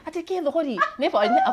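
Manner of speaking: a hint of an irritated old grandmother; grandma's voice. Grandma replies, confused.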